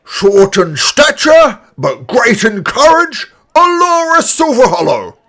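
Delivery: put-on booming old orc addressing the crowd; grand proclamation, slow and loud; elderly orc announcer.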